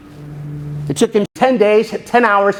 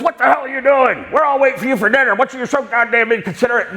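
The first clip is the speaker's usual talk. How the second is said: imitating an angry father, higher pitched; rapid-fire parental telling-off, acted out; gruffly scolding dad; 3 semitones higher than usual. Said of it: "didn't present things in the kindest possible way".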